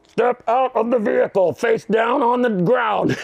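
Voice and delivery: high, slow shouted police order; mock police command. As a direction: bark it like a swat cop. Swat team ordering him out.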